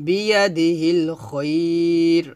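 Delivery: "খয়র" with the "oi" held long; stretched "khoir". Shown as a demonstration. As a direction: recite "বিয়া দি হিল খয়র", lingering on "khoiii" before the r. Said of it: "এভাবে খয়র এক লিপ টান দিব".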